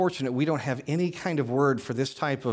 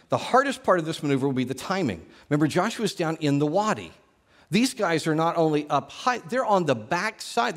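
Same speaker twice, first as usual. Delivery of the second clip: ordinary talk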